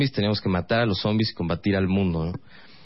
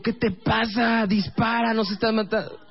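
higher, frantic gamer plea; frantic urging; 10 semitones higher than usual. Retold or imitated. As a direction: hurry her up mid-game. Urging girlfriend to shoot.

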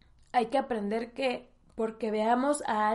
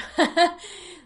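teary laugh; 6 semitones higher, 6 dB louder than usual. Real, not acted. Embarrassed after nearly crying.

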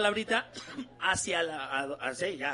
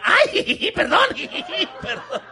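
giggly fake apology; 5 semitones higher, much slower than usual. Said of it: "ese jijiji".

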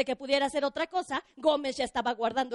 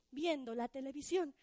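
ordinary delivery

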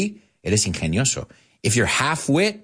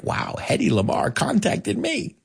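imitating a thrilled, higher-pitched wow; retold amazement; 10 semitones higher than usual. Mel Brooks marvels at being sued by Hedy Lamarr, retold amazement. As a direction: play him delighted to be contacted. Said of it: "He was like".

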